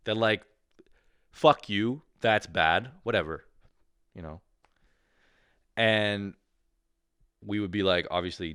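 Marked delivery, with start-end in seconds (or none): none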